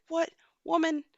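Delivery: high-pitched mock bafflement, breaking into a laugh; incredulous. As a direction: question her as if she's talking nonsense. Retold baffled reply to mother's stories.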